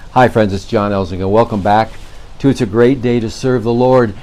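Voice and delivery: ordinary talk, nothing marked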